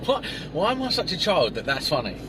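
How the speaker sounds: normal talking voice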